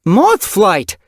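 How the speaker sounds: a loud high yowl of her name, falling. Acted. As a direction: call her back sharply. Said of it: "her mother's yowl".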